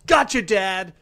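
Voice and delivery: high, loud mock shout; imitated kid's yell. Podcaster retelling a kid jumping on his back, mock yell. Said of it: "jumps on my back and yells".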